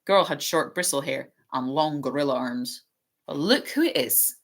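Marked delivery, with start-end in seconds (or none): 3.3-4.4 lazy drawl. A narrator's reading. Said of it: "in his usual lazy drawl".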